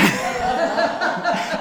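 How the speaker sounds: big, high burst of laughter; real laugh. Real, not acted. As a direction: crack up at the answer. Laughs at the ice cream answer.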